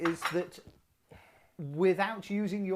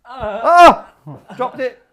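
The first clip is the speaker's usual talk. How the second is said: surprised exclamation; 6 semitones higher, 17 dB louder, much slower than usual. Real, not acted. Drops cookie mid-challenge.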